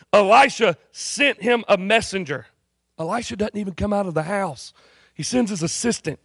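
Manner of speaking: ordinary talk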